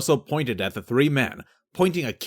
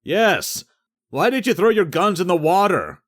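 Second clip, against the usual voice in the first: shouted across the water, louder and higher; yelled back; 8 semitones higher, 5 dB louder than usual. Acted. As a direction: call it across the water to the boat. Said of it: "one yelled back".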